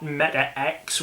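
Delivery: "Meta X", the X said as the letter's name; common mispronunciation. Shown as a demonstration. Host cites what people are saying, mispronounced "Meta X". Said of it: "what people are saying".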